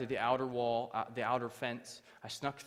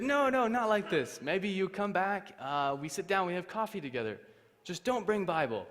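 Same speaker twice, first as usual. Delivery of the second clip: friendly official imitation; 8 semitones higher, 6 dB louder than usual. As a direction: coax like a foreign official inviting a guest. Officials reassure him in foreigner's English.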